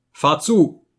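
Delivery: loud, high order; calling to the coachman. Acted. Narrator ordering the coachman to drive, loud and high. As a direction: bark it at the driver.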